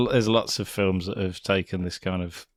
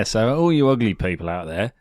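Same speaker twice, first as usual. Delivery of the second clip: ordinary talk, nothing marked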